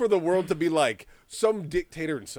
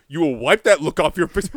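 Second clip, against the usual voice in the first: stern mom; 8 dB louder than usual. Acted. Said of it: "it's a mom".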